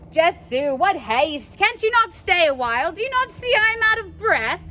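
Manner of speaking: indignant complaint. Acted. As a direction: scold her for rushing. Nurse protests she is winded.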